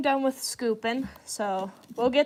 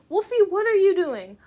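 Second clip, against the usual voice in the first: higher and louder call across the room; calling out to the cat; 8 semitones higher, 6 dB louder than usual. Real, not acted. Scolding cat climbing counter.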